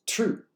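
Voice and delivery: True said high and loud; emphatic exclamation; exclaimed word. Shown as a demonstration. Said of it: "with an exclamation mark".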